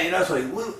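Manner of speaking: usual speaking voice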